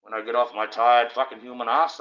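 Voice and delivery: ordinary talk, nothing marked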